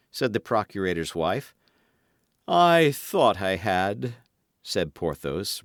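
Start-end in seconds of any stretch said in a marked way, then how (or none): 2.5-4.2 slow, sighing feigned sorrow; put-on melancholy. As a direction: drag it out with mock sadness. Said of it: "resuming his melancholy air".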